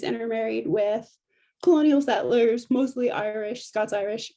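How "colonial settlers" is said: ordinary talk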